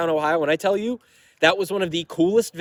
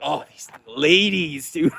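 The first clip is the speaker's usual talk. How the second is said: knowing mock exasperation; slower than usual. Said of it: "And I'm like".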